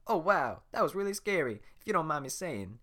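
fast-talking admirer; Donkey fanboying over Shrek; gushing Donkey. Acted. Donkey unfazed by roar.

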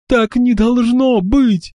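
high, slow, tearful outcry; angry crying. A narrator's reading. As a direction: cry it out through tears. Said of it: "вскричала она сквозь слёзы".

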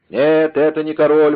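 loud, high-pitched denial; exclaimed. A narrator's reading. Rider corrects the deceived soldiers, exclaimed. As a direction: cry out to stop the mistake. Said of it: "воскликнул всадник".

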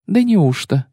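high mock-surprised sneer; sneering fake sweet. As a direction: feign amazement to mock him. Yennefer sneers in disbelief. (притворно-удивлённая насмешка)

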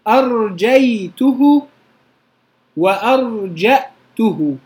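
slow أرجيته then وأرجأته with a hamza; two forms. Shown as a demonstration. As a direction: compare both forms. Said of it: "فيها لغتان".

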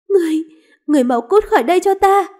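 high, fast, startled outburst; alarmed angry order. Acted. Woman dragged from bed ordering the man out, alarmed. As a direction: drive the intruder out at once.

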